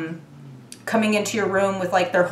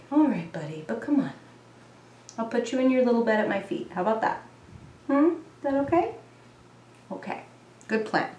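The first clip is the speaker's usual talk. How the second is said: tuck the cat in gently; soothing the cat; 3 semitones higher, 6 dB quieter, faster than usual. Real, not acted. Settling her old cat into its bed, softly.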